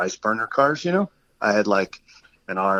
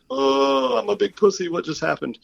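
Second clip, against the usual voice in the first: mock freaked-out wail; 11 semitones higher, 4 dB louder than usual. Reliving the stranger grabbing his hand, mock freaked-out wail. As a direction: whimper it high, sending up your own fright. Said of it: "this freaked me out so bad".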